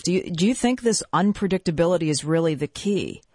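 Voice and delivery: ordinary talk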